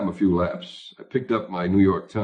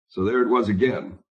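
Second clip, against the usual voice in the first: plain reading voice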